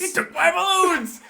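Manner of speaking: high-pitched mock villain outrage; hammed-up indignant cry; Joker yell. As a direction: wail it like a cheated cartoon villain. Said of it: "when the Joker yells".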